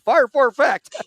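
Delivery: high, loud radio command; called-out fire order. Retold or imitated. Radio operator gives fire order.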